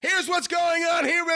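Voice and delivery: high, slow shouted announcing; announcer yell. Shown as a demonstration. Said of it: "a style of announcing that I think's ineffective".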